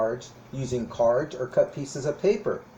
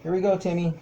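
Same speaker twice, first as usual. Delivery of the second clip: ordinary talk, nothing marked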